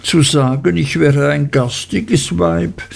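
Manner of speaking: high, whining complaint; mild mock lament from the young wife; wailing. A narrator's reading. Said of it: "jammerte Dora".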